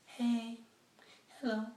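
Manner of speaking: high, dainty mock-woman greeting; put-on sweet feminine hello; sweet female voice. Shown as a demonstration. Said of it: "back in a sweet voice".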